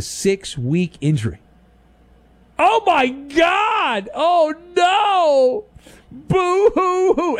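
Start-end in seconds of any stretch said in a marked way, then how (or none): 2.6-5.6 mock wailing. Mock despair over injury news. 6.1-7.4 high, slow hammed-up boo hoo; fake crying. Mock crying over injuries.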